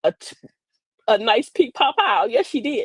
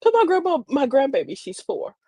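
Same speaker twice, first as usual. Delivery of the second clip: usual speaking voice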